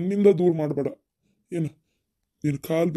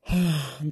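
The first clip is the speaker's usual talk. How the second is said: heavy sigh of resignation; weary sigh; 3 dB quieter than usual. Acted. Parent lamenting the game of fate, resigned sigh. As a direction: let out a long sigh.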